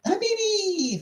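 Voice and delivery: very high drawn-out Habibi; high affectionate falsetto call; playful squeal. Real, not acted. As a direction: sing out a long, high greeting. Playful thanks to a viewer.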